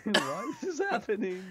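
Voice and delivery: high-pitched mock lament; despairing opponent. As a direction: wail it in playful, hammed-up despair. Voicing the despairing losing opponent.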